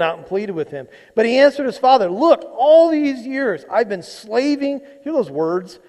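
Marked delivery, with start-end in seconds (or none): 2.1-4.8 dramatic whine. Acted. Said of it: "It's, it's a bit dramatic".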